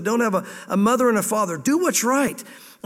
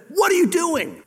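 alarmed parent; 8 semitones higher, slower than usual. Retold or imitated. Parent scolds child in road.

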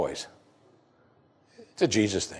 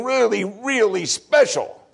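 slow emphasis; 7 semitones higher, slower than usual. Real, not acted. Emphasising word meaning.

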